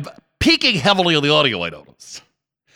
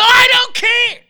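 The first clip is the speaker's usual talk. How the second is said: high, loud drawn yell; mock radio host yell; imitating shock-jock catchphrase; 5 dB louder, slower than usual. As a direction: leap into a high catchphrase shout. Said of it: "And then he go".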